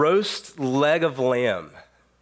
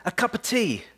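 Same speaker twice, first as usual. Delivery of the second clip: a much higher, prim British English "A cup of tea."; mock British accent; 9 semitones higher than usual. Picturing his hosts as quaint Britons, mock British accent. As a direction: play up a posh British teatime lilt. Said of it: "that's so British".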